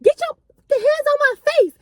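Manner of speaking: high sharp mock warning; imitating her getting serious, pitched high. Friend warning narrator off, high mock sharp voice. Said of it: "she said".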